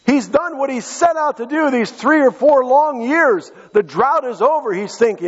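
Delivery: high, ringing preacher's cry; preaching at full force. Acted. Preacher to church, retelling Elijah, full force. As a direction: preach it out to the congregation.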